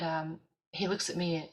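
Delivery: ordinary talk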